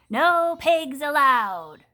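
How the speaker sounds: loud, very slow shout; furious shout. A narrator's reading. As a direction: bellow the rule at the pig. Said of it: "Eugenia shouted".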